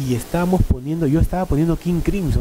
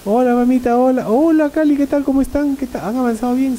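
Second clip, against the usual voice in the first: cheerful father greeting; 8 semitones higher than usual. Retold or imitated. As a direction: greet everyone like a delighted dad walking in. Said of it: "llega a Washington".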